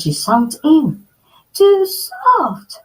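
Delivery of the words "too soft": high, louder whiny complaint; complaining. Acted. Girl stuck in Mama Bear's chair, whining high. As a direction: whine about sinking in. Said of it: "she complained".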